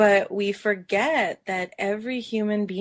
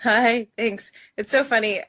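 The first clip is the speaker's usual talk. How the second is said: normal talking voice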